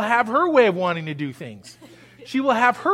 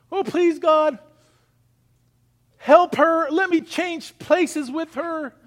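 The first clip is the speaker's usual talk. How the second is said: higher, pleading mock prayer, slowing at the end; imitating a begging prayer, higher; 6 semitones higher, slower than usual. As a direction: beg God like a desperate petitioner. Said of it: "you pray like you're begging God".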